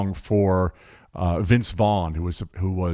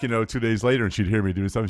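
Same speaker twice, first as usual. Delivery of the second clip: ordinary talk, nothing marked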